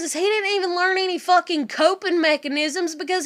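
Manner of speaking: ordinary talk, nothing marked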